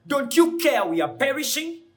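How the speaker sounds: loud, high, panicked plea; desperate cry for help. Retold or imitated. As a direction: shout it like men about to drown. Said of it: "they woke him up and says".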